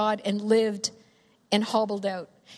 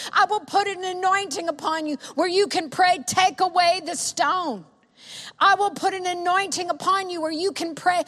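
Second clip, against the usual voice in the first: raised pitch, urgent driving sermon; impassioned preaching; 4 semitones higher than usual. Acted. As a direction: press each plea home with rising fervor. Preaches at rising force.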